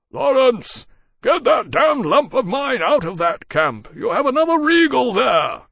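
very high, loud, sustained shouting; urgent yell. A narrator's reading. Berkeley warning Lawrence from outside the camp, yelling. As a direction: holler a warning across a distance. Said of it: "a voice yelled faintly from outside the camp".

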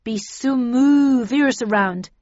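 loud, slow speculation; Snell's voice. A narrator's reading. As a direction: say it slowly and emphatically. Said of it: "continued Snell".

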